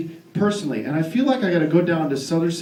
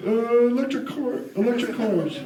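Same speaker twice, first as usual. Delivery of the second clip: smug salesman; 6 semitones higher, slower than usual. Retold or imitated. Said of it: "And he goes".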